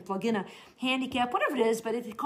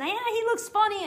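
snide thought; 10 semitones higher than usual. Retold or imitated. Voicing judgmental inner thought.

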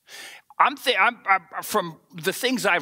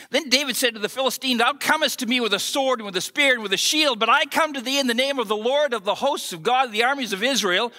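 quick, raised King James recital; scripture reading; 4 semitones higher than usual. Acted. Preacher reads David's words to Goliath aloud, proclaiming.

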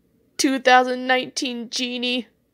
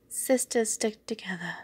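whisper; 8 dB quieter than usual. Acted. Unvoiced whisper line.

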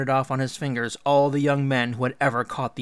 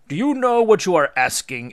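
raised, louder stern challenge; grave warning; 7 semitones higher, 8 dB louder than usual. A narrator's reading. Anu dismayed at his daughter's request, stern. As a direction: warn her in alarm.

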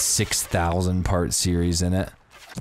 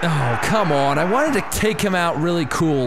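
high exasperated yell; loud high groan of frustration; frustrated outcry; 9 semitones higher, 3 dB louder than usual. Real, not acted. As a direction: blurt out the disappointment. Frustrated at missed kill.

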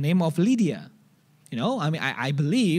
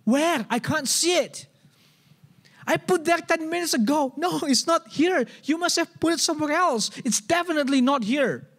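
higher, insistent complaining husband who can't find it; frustrated husband; 9 semitones higher than usual. Retold or imitated. Husband at the fridge whining to his wife, higher and insistent. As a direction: pitch it up, exasperated and sure it's gone.

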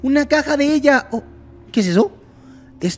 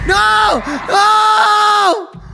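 frightened shout; 7 semitones higher than usual. Real, not acted. Gamer just jumpscared, shouting no. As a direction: cry out in fright.

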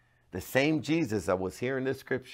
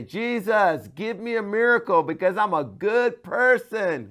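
mock entitled prayer; 9 semitones higher, 4 dB louder than usual. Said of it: "he didn't say".

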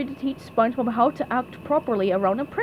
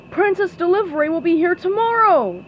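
loud, higher-pitched call; driver shouting back; 7 semitones higher, 5 dB louder than usual. Acted. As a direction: shout it back over engine noise. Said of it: "the driver shouted back".

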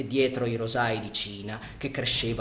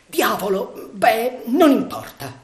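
higher and louder with each word; furious outburst; 10 semitones higher, 9 dB louder, slower than usual. Acted. Sandokan raging at the barred windows, rising shout. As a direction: burst out in rage at the bars.

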